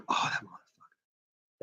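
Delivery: breathy voiceless mutter of triumph; inner whisper. Retold or imitated. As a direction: hiss it under your breath. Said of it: "'Cause I'm like".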